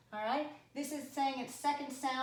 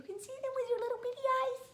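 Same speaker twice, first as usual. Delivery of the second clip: much higher, fast, tiny cutesy voice; squeaky baby talk; 14 semitones higher, faster than usual. Acted. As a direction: tease them in a squeaky little voice. Teasing kids about tiny eyes.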